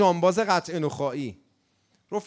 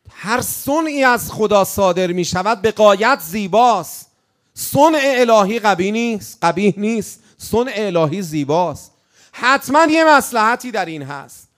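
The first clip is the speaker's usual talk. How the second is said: impassioned sermon; preaching at full force; 3 semitones higher, 7 dB louder than usual. Acted. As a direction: declaim it to the crowd. Loud preaching.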